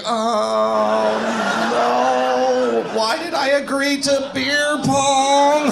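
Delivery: high, drawn-out wail of regret; hammed-up woman lamenting her mistake; mock regretful lady. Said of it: "That's a great impression of that lady".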